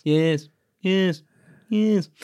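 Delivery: playful take on the character's yes, rising with every repeat; mimicking Alan Rickman. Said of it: "that bit when he's just keeps saying yes".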